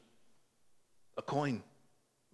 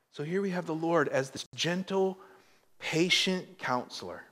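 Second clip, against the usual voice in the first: usual speaking voice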